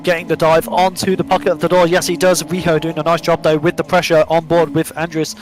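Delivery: loud, excited play-by-play; live race commentary. Real, not acted. Commentator calling the chase run for viewers, excited live commentary. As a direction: drive the call forward over the action.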